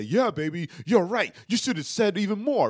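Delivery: mock-supportive partner hyping him up; egging him on. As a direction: play the over-eager yes-man, loudly. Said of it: "take my side and say".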